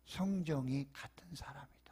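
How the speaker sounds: soft unvoiced whisper. Real, not acted. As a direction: whisper the scripture line. Scripture line whispered.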